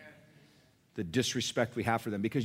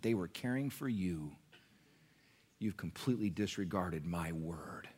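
hushed rebuke; 4 semitones lower, 6 dB quieter than usual. Acted. Hushed solemn Jesus rebuke.

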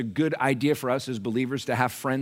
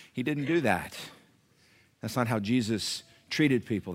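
ordinary delivery

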